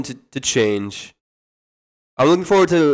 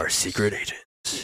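low, soft, slow trailer announcer; hushed mock trailer narrator; movie trailer voice; 5 semitones lower, 10 dB quieter, slower than usual. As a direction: draw out the tagline softly.

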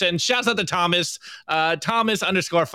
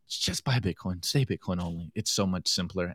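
quiet aside; 9 semitones lower, 8 dB quieter than usual. Real, not acted. Soft aside after punchline.

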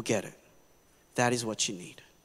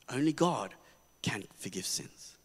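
"Only God" said quietly, then "can forgive sins" fading much softer and lower; quiet settling echo; soft restatement; 6 semitones lower, 8 dB quieter than usual. Shown as a demonstration.

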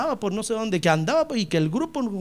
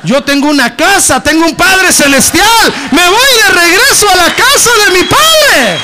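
building to a full shout, much higher and louder; 10 semitones higher, 13 dB louder than usual. Retold or imitated. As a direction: proclaim it at the top of the lungs. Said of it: "les dijo".

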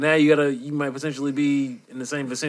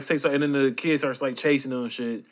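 ordinary talk

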